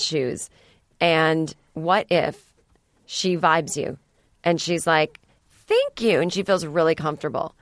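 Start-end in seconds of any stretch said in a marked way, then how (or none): 5.5-6.2 high, bright-pitched imitation of a pleased woman; mock woman's thanks. Woman thanking the man who complimented her shoes, high mock voice. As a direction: squeak it out like a flattered woman.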